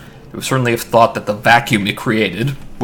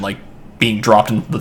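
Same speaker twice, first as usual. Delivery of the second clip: ordinary delivery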